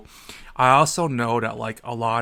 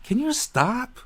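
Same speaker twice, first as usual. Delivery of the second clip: exasperated plea; 11 semitones higher, slower than usual. Retold or imitated. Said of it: "And I'm like".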